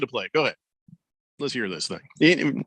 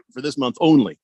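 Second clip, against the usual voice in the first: ordinary talk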